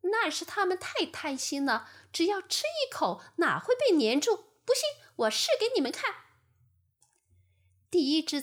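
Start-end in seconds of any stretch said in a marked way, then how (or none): 0.0-6.1 high fly voice, speeding up; boastful fly. Acted. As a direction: brag and dash off to prove it. (自信的苍蝇)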